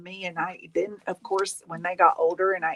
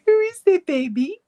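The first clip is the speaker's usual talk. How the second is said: high, louder coo; 9 semitones higher, 5 dB louder than usual. Real, not acted. Baby talk to grandson.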